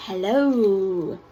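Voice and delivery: low, drawn-out hissing hello; lightly hissed snake hello. A narrator's reading. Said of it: "the snakes would hiss".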